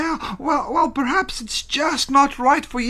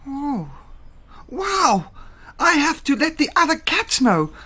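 high, excited discovery; amazed cat; 7 semitones higher than usual. Acted. Theo rushing to tell other cats, excited. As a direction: marvel in a high character voice.

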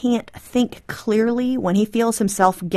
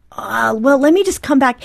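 pushy, louder and higher interjection; pushing back; 5 semitones higher, 7 dB louder than usual. Acted. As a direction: push your way back into the discussion. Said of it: "And you're pushing".

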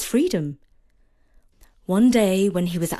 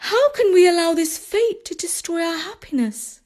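put-on high urgent young wife; anxious wife; 11 semitones higher than usual. Wife urges killing the dog.

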